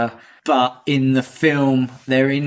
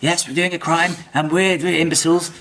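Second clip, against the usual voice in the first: lightly voiced chipper posh twits; a mild jolly toff voice. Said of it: "they're all very chipper".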